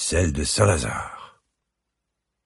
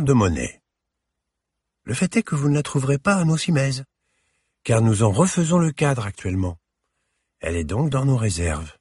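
put-on elegant older lady; 8 semitones higher than usual. A narrator's reading. Curator explains.

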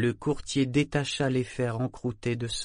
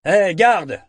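loud, much higher hail; calling out; 8 semitones higher, 12 dB louder than usual. A narrator's reading. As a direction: shout across the market for the guard. Calling a passing guard.

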